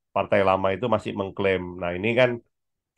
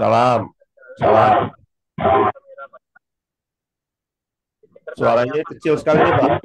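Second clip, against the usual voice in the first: calling out loudly; 3 semitones higher, 7 dB louder than usual. Real, not acted. Calling out to a faint caller.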